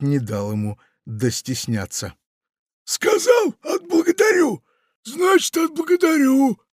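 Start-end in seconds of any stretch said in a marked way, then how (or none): 2.8-6.6 very high, louder insistent pirate; insist, won't take no. A narrator's reading. Pirate insists on rewarding Funtik, very high and loud. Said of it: "настаивал он".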